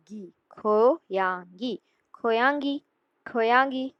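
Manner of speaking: खोयांगी drawn out slowly, kho-yang-gi; slow teaching demo. Shown as a demonstration. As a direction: stretch खोयांगी for the learner. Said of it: "ये ग की sound next में यहाँ पर आ जाएगी". (खोयांगी को धीरे धीरे, खो-यांग-गी)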